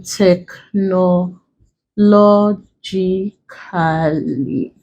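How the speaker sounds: TECHNOLOGICALLY stretched out syllable by syllable, very slowly; broken into syllables. Shown as a demonstration. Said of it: "if you break this one".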